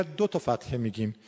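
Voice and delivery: ordinary delivery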